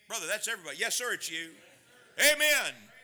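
ordinary delivery

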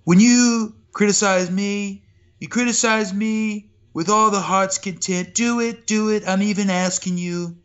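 hint of a Trump rally boast; Trump impression. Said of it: "Can you do a Trump".

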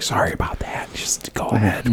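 soft apologetic whisper; hushed pretend whisper to the girl. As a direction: whisper it aside so only she hears. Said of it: "whisper to the girl".

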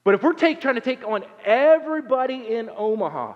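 high, loud comic exaggeration; emphatic exclamation. Pastor tells Sean the fight is absurd, loud exaggeration.

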